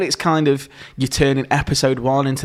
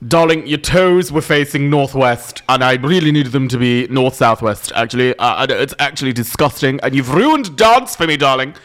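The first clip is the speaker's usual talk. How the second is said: Shirley Ballas. Acted. Mock judge tells a dancer off over toes, theatrical disgust. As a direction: pick the footwork apart like a grand judge. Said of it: "What a fantastic impression of Shirley Ballas".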